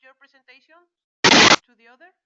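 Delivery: faint voice over a bad line; very faint, as if far from the mic; barely audible. Real, not acted. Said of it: "I can barely hear you".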